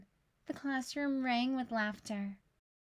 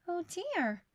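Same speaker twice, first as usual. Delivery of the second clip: a light worried sigh of a word; mild dismay; 6 semitones higher than usual. Acted. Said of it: "she said".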